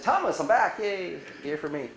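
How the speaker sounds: high, chirpy hello to the doctor; mock cheery greeting. Patient greeting her knee surgeon, chirpy and high. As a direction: bounce in like it's good news.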